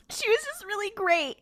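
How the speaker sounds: high-pitched mock-emotional insistence; hammed-up distressed praise; upset roommate. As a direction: imitate an upset friend, voice pushed up high. Upset roommate pressed for details.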